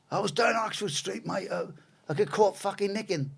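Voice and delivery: high-pitched petty thief voice; imitated London prisoner. Oxford Street thief answering from his cell, imitated inmate voice.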